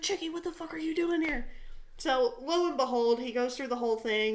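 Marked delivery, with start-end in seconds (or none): 0.0-1.5 higher-pitched but not shouted; toned-down incredulous question; retold disbelief. Soldier recognizes his friend from home, mild mock disbelief. Same outburst repeated with the name.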